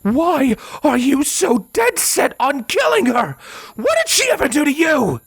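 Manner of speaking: high, raging shout; furious screamed demand, pitched very high; enraged roar. Acted. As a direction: let the rage spill out as you yell. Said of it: "her rage began to spill forth".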